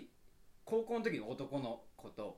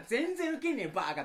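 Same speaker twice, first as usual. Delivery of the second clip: inner scorn; 9 semitones higher, 9 dB louder than usual. Retold or imitated. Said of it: "とか思いながら".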